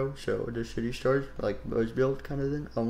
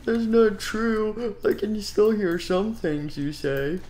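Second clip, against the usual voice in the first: higher, slow hurt whine; teased kid answers back, higher and slow; offended protest; 8 semitones higher, slower than usual. Acted. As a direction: protest like a wounded little kid.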